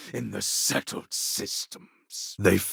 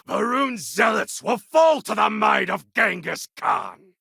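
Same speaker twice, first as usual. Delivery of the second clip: shouted, much higher war cry; triumphant warlord war cry; battle cry; 11 semitones higher, 3 dB louder than usual. Acted. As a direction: bellow it at the enemy. Battle cry against enemies.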